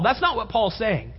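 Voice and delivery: ordinary talk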